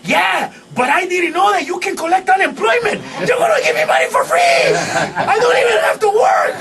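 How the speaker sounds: high, excited shouting, imitating a happy cousin; overjoyed. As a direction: build to hammed-up, high-pitched glee. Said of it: "And he was happy".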